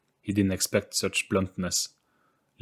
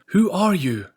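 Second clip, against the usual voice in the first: high, loud surprised question; surprised exclaim; 11 semitones higher, 7 dB louder than usual. Acted. William, surprised, exclaims at the strange girl, high and loud. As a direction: blurt it out, wide-eyed. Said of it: "couldn't help but exclaim".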